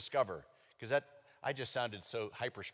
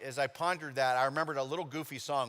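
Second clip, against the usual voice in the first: normal talking voice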